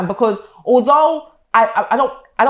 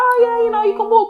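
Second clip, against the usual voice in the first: much higher, sing-song reassurance; mock-sweet mum voice; 12 semitones higher than usual. Mum answering Kiana about walking, high and sing-song. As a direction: lightly play the sugary mum on the phone. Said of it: "I'll be like".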